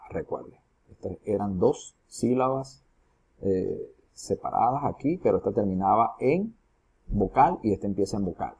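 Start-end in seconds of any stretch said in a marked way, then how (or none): none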